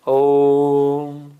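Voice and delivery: ओम with the ओ stretched long and low; prolonged Om. Shown as a demonstration. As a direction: draw out ओम slowly. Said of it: "यूं लिखे हुए देखा". (ओम को लंबा खींचकर)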